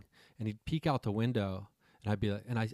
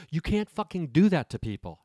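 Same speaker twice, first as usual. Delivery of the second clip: raised, louder scolding; mock scolding; 6 semitones higher, 5 dB louder than usual. Retelling Dave scolding the girl, loud rebuke. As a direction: tell her off like an outraged victim.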